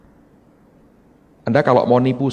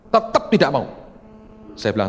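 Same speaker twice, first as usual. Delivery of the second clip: ordinary delivery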